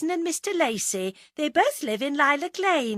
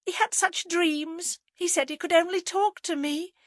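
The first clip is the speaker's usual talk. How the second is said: tearful adoration; 10 semitones higher, 3 dB louder than usual. A narrator's reading. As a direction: gush with grief, pitched well up. Dabs eyes, mourns dead lover.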